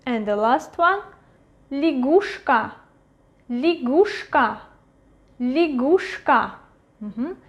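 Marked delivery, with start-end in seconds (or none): 1.7-6.5 лягушка with я as i, stress on the u; reduced unstressed Ya. Shown as a demonstration. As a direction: say лягушка as li-GUSH-ka, carefully modelled. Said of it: "It is pronounced as И".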